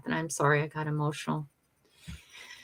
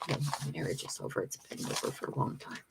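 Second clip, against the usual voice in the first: quiet resigned; 4 semitones lower, 11 dB quieter than usual. Real, not acted. Answers about marriage ending.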